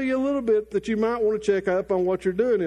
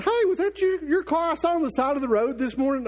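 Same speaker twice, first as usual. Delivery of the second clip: curious friend; 6 semitones higher than usual. Retold or imitated. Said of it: "one of our friends said".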